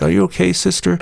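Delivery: high, gentle voice of concern; acted sympathetic question, lightly done; imitating concerned member. Imagined concerned church member asking.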